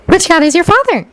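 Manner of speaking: high, keen question; intent. A narrator's reading. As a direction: ask with intent curiosity. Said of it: "Hermione asked, intent".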